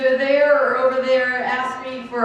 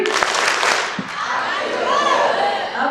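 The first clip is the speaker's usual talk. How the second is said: excited shout; much slower than usual. Real, not acted. Leading the crowd chant.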